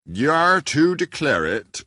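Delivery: slow, solemn parson intoning from the pulpit; a slow churchy parson voice; parson's words. A narrator's reading. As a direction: preach it slowly, like a parson. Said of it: "was the parson's words".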